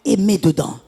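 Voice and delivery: normal talking voice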